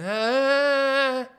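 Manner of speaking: high, loud mock old-man yell. Imitating the old guy yelling in the coffee shop. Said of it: "this old guy yelling".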